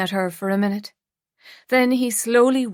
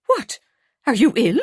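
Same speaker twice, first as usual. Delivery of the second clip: alarmed; 3 semitones higher, 4 dB louder than usual. A narrator's reading. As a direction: jolt of worry. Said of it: "Sylvia Fleury sounded alarmed".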